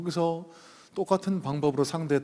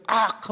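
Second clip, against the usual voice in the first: sharp high mock cry of pain; acted yell of someone clutching a leg; retold pained yelp; 9 semitones higher, 8 dB louder, much slower than usual. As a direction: yelp "악!" as if hurt, loud and high. Man cries out when tripped.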